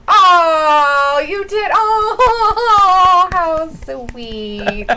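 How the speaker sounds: loud, drawn-out gushing coo. Real, not acted. Host reacts to news of a first kiss, gushing. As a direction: gush with long swooping ohs.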